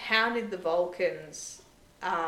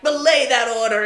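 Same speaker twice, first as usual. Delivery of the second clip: louder stern order; commanding countermand; 5 semitones higher, 13 dB louder than usual. Retold or imitated. Captain storming in to stop Michael, loud stern command. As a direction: cut the order off hard.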